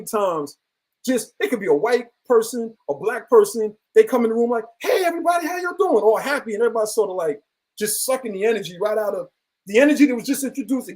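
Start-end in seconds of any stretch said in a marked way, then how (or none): 4.8-6.0 high, bright over-the-top hello; a hammed-up chirpy entrance; mock cheery greeting. Cheery person entering the room.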